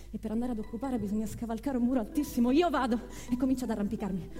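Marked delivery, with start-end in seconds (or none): 2.5-3.0 louder, higher declaration; defiant. Acted. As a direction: announce it defiantly, voice raised. Acted defiant reply in quarrel.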